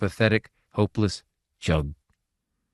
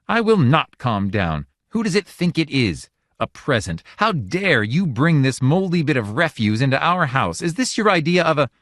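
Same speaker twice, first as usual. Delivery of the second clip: high, louder outraged tirade; furious refusal; 7 semitones higher, 4 dB louder than usual. A narrator's reading. Wife raging at husband over the gift, furious. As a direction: rant at the husband and god.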